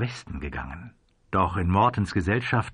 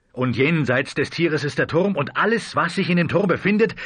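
high, agitated pleading; strained outburst breaking into begging; pleading, fighting for firmness; 6 semitones higher, 3 dB louder than usual. A narrator's reading. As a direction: plead desperately, pitch climbing. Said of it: "bemühte sich um Festigkeit und verfiel in Flehen".

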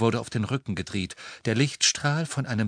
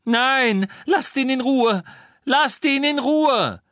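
high, loud, desperate scream; 7 dB louder, slower than usual. A narrator's reading. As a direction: scream to make them stop hurting him. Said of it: "Hermine schrie".

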